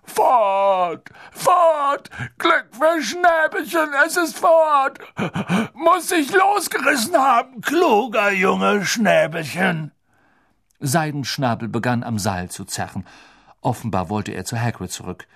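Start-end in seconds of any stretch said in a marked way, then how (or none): 0.0-9.9 higher, louder tearful cries; sobbing with joy. Acted. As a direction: blubber through relief. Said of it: "durch sein Schluchzen".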